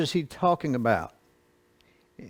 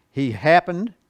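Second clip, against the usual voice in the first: ordinary talk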